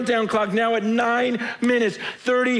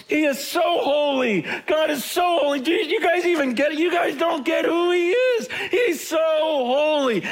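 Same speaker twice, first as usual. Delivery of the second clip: angels crying out; 7 semitones higher than usual. Acted. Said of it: "those angels right now are in heaven going".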